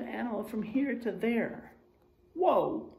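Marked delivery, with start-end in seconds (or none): none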